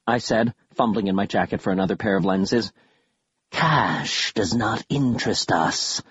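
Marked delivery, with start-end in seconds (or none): 3.5-6.0 dark whisper behind you. Acted. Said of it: "another voice whispered".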